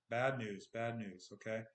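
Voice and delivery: ordinary delivery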